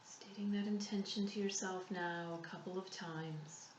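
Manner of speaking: calm, unhurried meditation voice with long pauses; soothing body-scan guide, softening as it goes; guided relaxation. Real, not acted.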